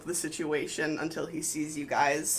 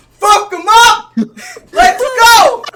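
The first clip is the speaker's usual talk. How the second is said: huge, high, drawn-out cheering yell; over-aggressive sideline parent; 24 dB louder, slower than usual. Acted. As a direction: scream them on like a soccer parent. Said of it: "Delphina from the sideline".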